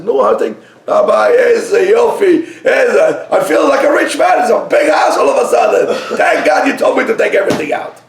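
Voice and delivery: high, loud, shouted relief; overjoyed thanks. Acted. Husband loudly thanking the rabbi, high and overjoyed. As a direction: burst with joy like a rich man.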